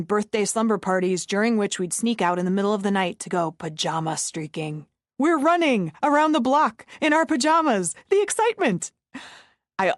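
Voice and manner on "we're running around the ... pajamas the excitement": much higher, gushing with thrill; hammed-up breathless glee; mock excitement. Mock thrill about pajama streaking.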